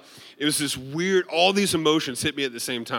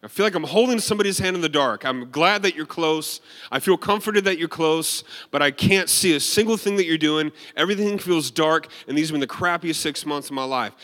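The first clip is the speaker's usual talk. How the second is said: raised, louder impassioned complaint; retold yelling at God; 5 semitones higher, 4 dB louder than usual. Man in a broken-down car pleading with God, retold yelling. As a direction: re-enact a frustrated outpouring, high and insistent. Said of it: "I was yelling at God in my car".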